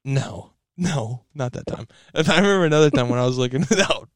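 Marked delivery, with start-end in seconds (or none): none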